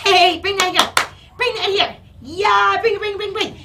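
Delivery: high, loud cheering; squealed, eager encouragement; excited praise. Real, not acted. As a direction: call the dog back with delight. Cheering dog into bringing toy back.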